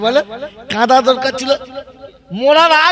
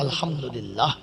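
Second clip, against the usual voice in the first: muttered aside; 7 semitones lower, 12 dB quieter than usual. Retold or imitated. Mocking a man's reaction.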